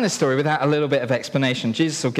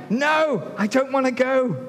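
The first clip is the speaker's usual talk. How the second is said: high, whiny no, I don't wanna go; sulky son; 10 semitones higher, slower than usual. Acted. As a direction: play the reluctant child. Voicing the refusing son.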